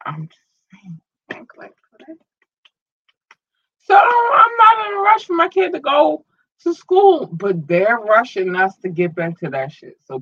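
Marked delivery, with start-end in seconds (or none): 0.1-2.2 soft unvoiced aside; whispering. Real, not acted. Aside after a rant. 3.8-7.3 high, loud, voice breaking; crying out. Real, not acted. Passionate point about her kid.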